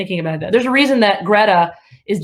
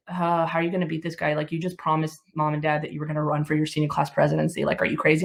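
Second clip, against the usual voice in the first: lightly voiced brother, softer, low; imitating brother; 5 semitones lower, 7 dB quieter than usual. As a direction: brother scolding quietly across the table.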